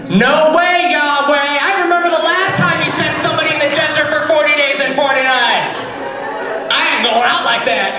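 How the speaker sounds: high, fast, hammed-up complaining; whiny Elijah voice. Preacher's Elijah refusing God's errand, high and whiny. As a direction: whine your refusal up high.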